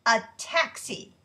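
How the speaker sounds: loud angry call for a taxi; loud demand. Acted. Storming out of the restaurant.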